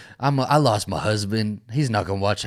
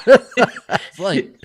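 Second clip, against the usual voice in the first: loud high laugh spilling into words; big laugh; 12 dB louder than usual. Real, not acted. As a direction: laugh hard at the joke. Laughs at Lawrence's line.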